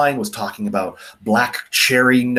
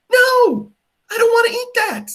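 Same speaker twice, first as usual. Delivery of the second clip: much higher, louder crying whine, fast; whiny child refusal; 4 dB louder than usual. Pretend. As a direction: wail like a kid refusing food. Childlike refusal to eat leather.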